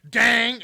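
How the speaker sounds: loud, high "dang" of metal on metal; imitated metal clang. Imitating a water bottle hitting the boat, loud clang. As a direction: burst out with a sudden ringing clang. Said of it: "all of a sudden you hear".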